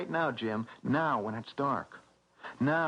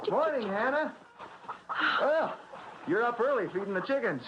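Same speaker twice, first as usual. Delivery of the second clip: cheerful greeting; 7 semitones higher than usual. Acted. Charlie calling out a cheery morning to Hannah. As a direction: sing out a hearty hello across the yard.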